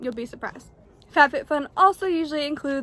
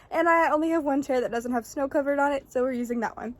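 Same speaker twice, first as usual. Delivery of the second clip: usual speaking voice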